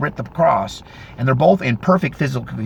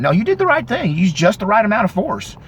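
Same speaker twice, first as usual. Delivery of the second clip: higher-pitched, emphatic reassurance; imitated approving bystanders; 7 semitones higher than usual. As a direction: lightly act out their eager approval. Bystanders reassuring him, acted out.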